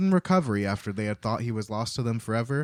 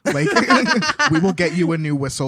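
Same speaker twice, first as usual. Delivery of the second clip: scolding; 6 semitones higher, 7 dB louder than usual. Retold or imitated. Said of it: "Her tone, I think, comes across as adult".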